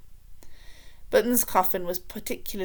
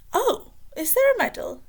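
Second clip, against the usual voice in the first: much higher, delighted surprise; surprised king; 6 dB louder than usual. A narrator's reading. Vain king hearing of a medal, high and thrilled. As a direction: perk right up at the word medal. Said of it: "said Fred".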